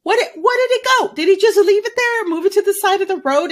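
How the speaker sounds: high and fast, rushing through the questions; excited. Real, not acted. Speculating to her co-host about the werewolf body, fast and excited. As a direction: tumble out the guesses with rising curiosity.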